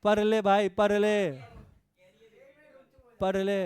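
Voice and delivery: loud insistent command; urging loudly. Real, not acted. Teacher orders students to study, loud and insistent. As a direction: bark it at them: study!.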